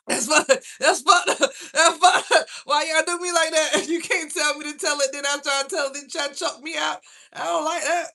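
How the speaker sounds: high, loud, laughing complaint; laughing protest. Real, not acted. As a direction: laugh while scolding the chat. Playfully scolding chat, laughing.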